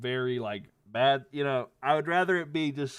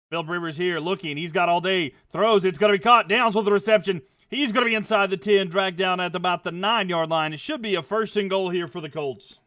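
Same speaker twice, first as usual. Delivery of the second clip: loud, higher catch-and-run call; excited play call; 5 semitones higher, 8 dB louder than usual. Acted. Calling the drive to the nine for the chat, loud. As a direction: ride the play down to the goal line.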